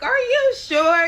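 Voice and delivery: high, loud, drawn-out disbelief; incredulous questioning. Retold or imitated. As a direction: stretch it out in high-pitched disbelief. Acted shocked question to pregnant rival.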